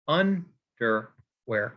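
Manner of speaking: "underwear" broken into UN, der, wear with pauses; very slow "underwear", UN first, then two falling syllables; stress on first syllable. Shown as a demonstration. Teacher marking a dactyl, "underwear" split slowly with first syllable stressed. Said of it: "the first syllable stressed and then".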